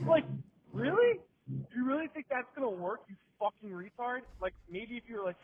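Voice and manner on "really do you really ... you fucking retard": high-pitched incredulous mocking; scornful disbelief. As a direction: question him high, in mock disbelief. Mocks the beggar's approach.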